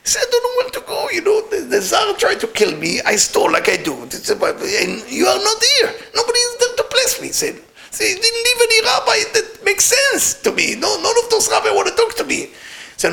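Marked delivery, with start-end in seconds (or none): 0.3-7.3 very high, tearful mock wail; wailing plea. Thief telling his dead rabbi he is abandoned, wailing. 7.9-12.4 very high, plaintive mock whine; wailing complaint. Thief complaining that no rabbi will have him, plaintive. Thief keeps lamenting to dead master.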